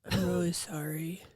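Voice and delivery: soft unvoiced whisper; whispered apology. Mom imitating daughter, whispering a guilty apology. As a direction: whisper the sorry like a guilty kid.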